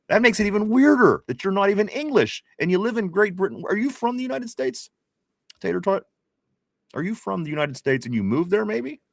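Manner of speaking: high-pitched, puzzled disbelief; incredulous. Real, not acted. Host quizzing a chatter about being English, incredulous. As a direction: question them in high, baffled amazement.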